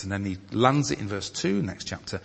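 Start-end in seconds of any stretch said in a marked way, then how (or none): none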